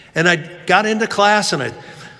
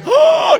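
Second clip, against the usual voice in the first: high, loud rehearsed gasp; fake shocked gasp; 5 dB louder than usual. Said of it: "practicing, looks of shock".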